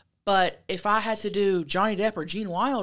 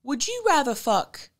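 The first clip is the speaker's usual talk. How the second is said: higher-pitched mock British caller; put-on British accent; 6 semitones higher than usual. Host starts copying the England caller in a British accent. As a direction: imitate an English caller, pitched up. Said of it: "this is my best British accent".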